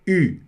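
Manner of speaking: "U" said as French ü, lips in a tight pout; puckered French vowel on "U"; French pursed-lip u. Shown as a demonstration. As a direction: say "U" like an ee through rounded lips. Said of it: "porque é o U, você pronuncia".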